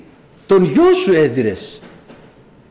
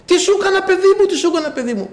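crying and wailing; 12 semitones higher than usual. Retold or imitated. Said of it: "φώναζε".

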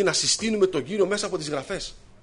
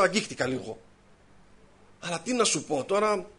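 ordinary delivery

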